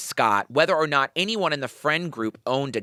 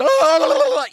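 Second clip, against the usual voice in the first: loud, high goat-like scream; screaming goat imitation; 12 semitones higher, 7 dB louder than usual. Host imitating Scott's rapping, screaming goat noise. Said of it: "screaming gopes. It's like".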